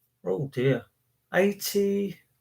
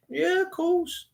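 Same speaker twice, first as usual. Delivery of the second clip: mock celebrity reply; 12 semitones higher, 7 dB quieter, slower than usual. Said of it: "And then".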